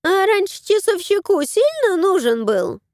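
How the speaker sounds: high, squawky crow. Acted. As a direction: voice the crow, nosy. Crow character voice.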